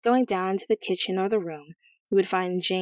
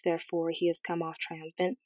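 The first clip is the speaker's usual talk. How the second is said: ordinary delivery, nothing marked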